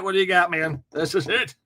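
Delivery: normal talking voice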